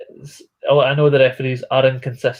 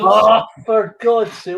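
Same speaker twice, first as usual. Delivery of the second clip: loud, high, drawn-out exasperation; exasperated outburst; 7 semitones higher, 5 dB louder, slower than usual. Real, not acted. Commentator reacting to a missed chance, exasperated. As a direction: groan it out loudly and slowly.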